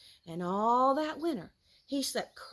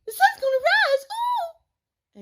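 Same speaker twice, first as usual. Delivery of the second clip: high, loud squeaky squirrel voice; tiny squirrel piping loud; 9 dB louder than usual. Acted. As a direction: pipe up high and excited. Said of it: "little brown squirrel piped up".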